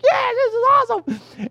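overjoyed shout; ecstatic. Retold or imitated. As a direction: squeal it out in delight. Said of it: "We were like".

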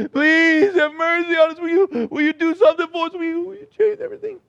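high, hurried mock pleading; hammed-up imploring, speeding up; begging blind men. Acts blind men begging in the house.

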